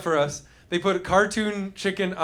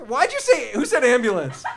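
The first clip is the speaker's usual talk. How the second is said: usual speaking voice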